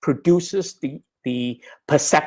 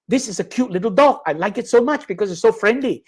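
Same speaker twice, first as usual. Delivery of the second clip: quicker, higher, charmed by the puppy; delighted inner voice; 5 semitones higher, faster than usual. Pretend. Listener's imagined delight at the puppy, lightly gushing. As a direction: gush a little over a cute dog.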